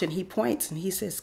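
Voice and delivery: normal talking voice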